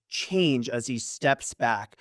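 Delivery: ordinary talk, nothing marked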